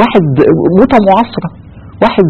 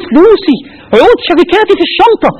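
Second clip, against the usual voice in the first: much higher, clutching at his money in panic; desperate dying man; 3 dB louder than usual. Retold or imitated. Dying passenger on the plane begging for his briefcase, high and frantic. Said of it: "يقول له".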